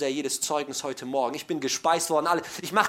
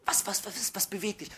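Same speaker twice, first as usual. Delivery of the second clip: softer, lightly anxious questioning; mock worried wife; 3 semitones higher, 6 dB quieter than usual. Wife asks troubled husband what moves him, mildly worried. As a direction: stammer out a concerned question, softly. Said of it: "die Frau fragt ihn ganz besorgt".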